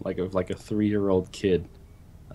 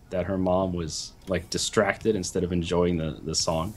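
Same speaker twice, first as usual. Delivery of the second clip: ordinary talk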